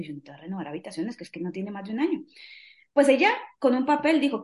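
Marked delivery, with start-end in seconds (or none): 0.0-2.2 lower, softer and fast; hint of a no-nonsense mother; mock firm refusal. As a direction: brush off the request quickly and quietly. Mother refusing daughter's request.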